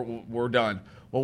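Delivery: normal talking voice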